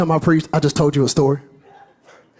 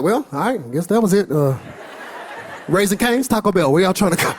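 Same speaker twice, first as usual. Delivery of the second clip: shrugging congregant. Retold or imitated. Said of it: "Most of y'all be like".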